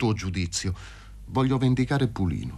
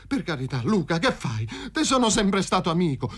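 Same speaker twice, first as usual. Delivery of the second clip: high, anxious begging; pleading; 6 semitones higher than usual. A narrator's reading. Paroni begs his friend at gunpoint, pleading. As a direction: beg for mercy, pitch climbing. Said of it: "prese a scongiurare Paroni".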